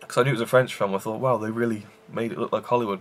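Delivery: normal talking voice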